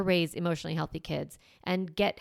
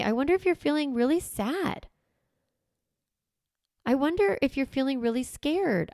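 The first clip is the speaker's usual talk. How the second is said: higher-pitched, wondering guess at a feeling; tentative questioning; 5 semitones higher than usual. Shown as a demonstration. Parent naming an upset child's feelings, tentative questioning. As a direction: offer each feeling as an open question. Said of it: "say it like that as a question".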